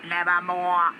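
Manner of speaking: slow, drawn-out croaking raven. Acted. Raven impressed by the landing planes, croaking slowly.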